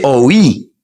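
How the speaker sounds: «oh oui» said as two separate vowels, o then i; Spanish-style «oh oui» with split vowels. Shown as a demonstration. Teacher showing learners the Spanish «oh oui». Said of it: "Parce qu'en espagnol".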